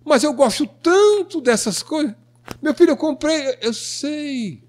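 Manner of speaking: lightly mocking a pleading spouse; imitating the wife. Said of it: "Fazendo a esposa".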